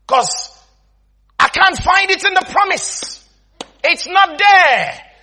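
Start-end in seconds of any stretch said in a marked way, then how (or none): none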